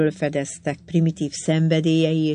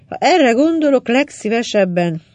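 angry outburst; 6 semitones higher, 5 dB louder than usual. A narrator's reading. Tarvin fuming about her father.

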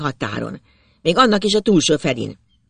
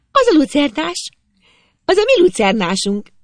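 very high, raised voice; girl shouting; 12 semitones higher, 4 dB louder than usual. A narrator's reading. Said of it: "azt kiáltja Zsuzsi".

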